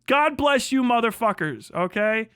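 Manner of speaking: ordinary talk, nothing marked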